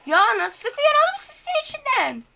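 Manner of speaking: high, exasperated outburst; can't believe how they're hitting; disbelief. Real, not acted. Frustrated gamer yelling at opponents. (inanılmaz şaşkınlık)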